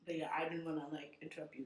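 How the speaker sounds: ordinary delivery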